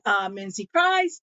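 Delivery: normal talking voice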